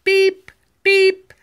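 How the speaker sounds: high loud beep; loud horn beep. Acted. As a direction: honk it out brightly. Final truck horn.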